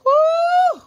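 loud high whoop of triumph; cheering whoop. Real, not acted. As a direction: whoop for the finish. Celebrates finishing challenge.

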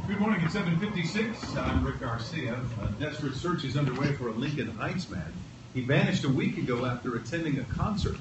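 measured radio newscaster delivery; news read. Acted.

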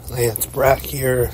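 usual speaking voice

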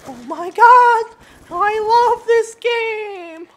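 drawn-out sarcastic cheer; sarcastic shout. Real, not acted. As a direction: yell the fake delight. Sarcastic outburst at game.

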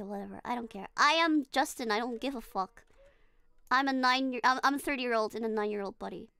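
ordinary talk

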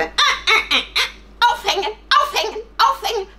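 high, loud monkey chatter; excited monkey. Acted. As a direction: screech like a gleeful ape. Monkey calls out the punishment. (aufgeregt kreischender Affe)

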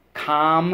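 Complete. called out high, syllables stretched; slow drilled word. Shown as a demonstration. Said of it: "Let's go".

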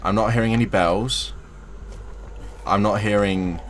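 ordinary delivery

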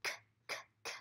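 soft unvoiced k, k, k like a scratching cat; whispered k sounds. Shown as a demonstration. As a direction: breathe out the k sounds like claws scratching. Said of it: "Do you hear that?".

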